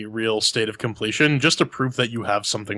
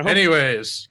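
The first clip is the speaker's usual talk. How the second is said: ordinary delivery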